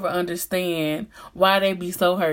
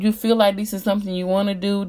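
ordinary talk, nothing marked

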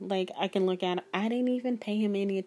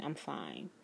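almost gone, fighting tears; barely said, tears held in; fading, held-back tears; 7 semitones lower, 10 dB quieter than usual. Retold or imitated. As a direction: lower and much softer, trailing off. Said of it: "I'm holding back tears".

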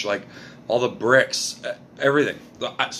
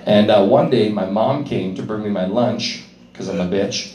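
ordinary talk

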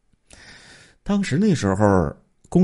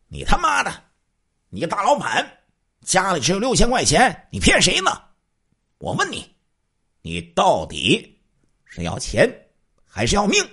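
high, loud angry swearing; loud cursing; 12 semitones higher than usual. Acted. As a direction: robber screams abuse at the mother. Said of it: "这瘦子大声骂道". (高声怒骂)

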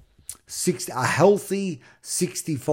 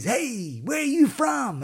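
calling out; 11 semitones higher than usual. Retold or imitated. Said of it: "He calls out to me".

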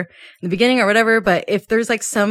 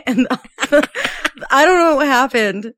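laughing; 6 semitones higher, 4 dB louder, much slower than usual. Real, not acted. Laughing recounting lost audio.

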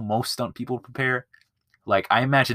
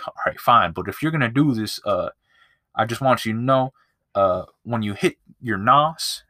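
usual speaking voice

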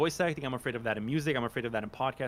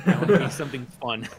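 normal talking voice

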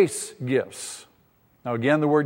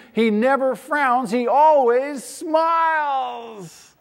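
rising higher and louder, building to a ringing shout; preaching at full force; 6 semitones higher, 4 dB louder than usual. Real, not acted. Pastor proclaiming grace to his congregation, building to a shout. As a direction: lift the congregation, climbing to a cry on smiles.

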